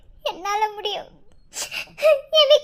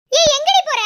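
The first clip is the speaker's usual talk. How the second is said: loud, higher-pitched demand; sharp challenge; 4 semitones higher, 6 dB louder than usual. Acted. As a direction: call out sharply to stop them. Elder challenges someone leaving. (அதட்டும் குரலில் சத்தமாக)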